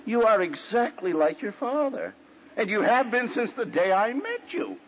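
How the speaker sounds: mock marital telling-off; retold accusation. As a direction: press the accusation home as the wife. Wife confronts husband in retold quarrel.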